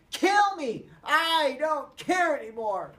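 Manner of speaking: loud high cry, slowing at the end; furious despair; desperate shout. Acted. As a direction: scream it, then drag out the last words. Peter raging, then despair.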